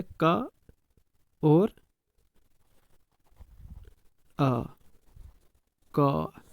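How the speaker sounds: का broken into its sounds, then joined again; splitting का into sounds. Shown as a demonstration. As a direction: pull का apart, then join it. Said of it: "दो sounds आ रही है". (का को ध्वनियों में तोड़कर बोलना)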